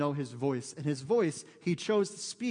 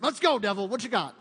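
loud, higher mock taunt; bold challenge; 5 semitones higher, 6 dB louder than usual. Preacher imitating a believer challenging the devil, fired-up. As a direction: call out a cocky dare. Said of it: "Charging the gates of hell".

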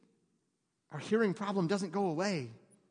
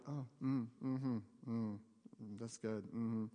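much softer, lower drowsy mm-hmms; mock murmured approval; 7 semitones lower, 10 dB quieter, much slower than usual. Pastor mimics churchgoers half-listening, soft lazy murmuring. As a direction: hum along without really listening. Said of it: "put this, our ears on".

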